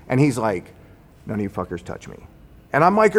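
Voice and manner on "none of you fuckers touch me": low, much softer imitation of a threat; retold low warning. Engineer with a handgun warning the group, low retold threat. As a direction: drop pitch and volume to mimic his warning.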